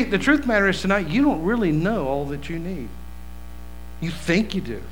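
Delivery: normal talking voice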